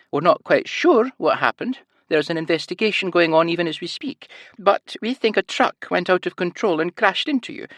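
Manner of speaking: high sing-song Scottish lilt, stressing words; cheerful high nurse explaining. A narrator's reading. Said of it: "a heavy Scottish accent and a high-pitched sing-song voice".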